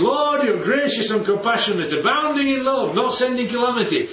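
glad, adoring praise. Shown as a demonstration. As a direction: say it as heartfelt worship. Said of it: "that's praise".

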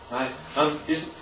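usual speaking voice

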